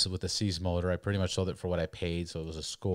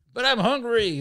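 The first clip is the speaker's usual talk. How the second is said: much higher, louder, mock-whining protest; whiny drunk plea; 9 dB louder than usual. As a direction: play the pleading drunk kid. Drunk plea at drive-thru.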